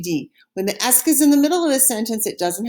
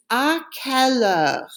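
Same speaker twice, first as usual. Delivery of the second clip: slow model phrase; 3 semitones higher, slower than usual. Shown as a demonstration. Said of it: "Say it after me".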